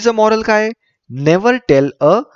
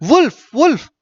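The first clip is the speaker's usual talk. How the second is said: mild story shout; 8 semitones higher than usual. A narrator's reading. Shepherd boy calling villagers for a prank, mild acted shout. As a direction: call it out high, like a storybook alarm. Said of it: "He started shouting".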